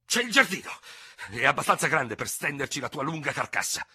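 high, loud headlong sputtering; impetuous outburst. Acted. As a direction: blurt it out in a furious rush. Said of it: "farfugliò l'altro con tanto impeto". (sfogo impetuoso, acuto e forte)

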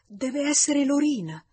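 usual speaking voice

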